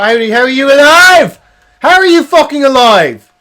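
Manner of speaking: furious, high and loud; angry shout. Real, not acted. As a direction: yell it in real disbelief at the game. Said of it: "I'm sorry I shouted".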